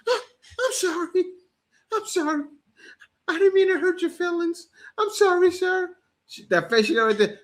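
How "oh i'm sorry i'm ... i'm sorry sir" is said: very high, whiny pleading voice; mock scared woman. Woman apologizing to the man, high mock-scared pleading. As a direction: squeak out the apology like a frightened girl. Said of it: "When he ran up on you".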